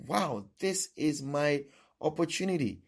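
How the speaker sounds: high-pitched, thrilled outburst; excited realisation. Acted. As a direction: seize on it with sudden hope. Acting blind man seizing his chance.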